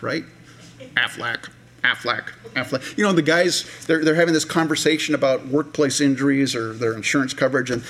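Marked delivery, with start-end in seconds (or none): 1.0-3.0 a light duck-like squawk of the brand name; a hint of a duck squawking the slogan; Aflac duck. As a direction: quack the name like the ad's duck. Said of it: "You know the Aflac duck".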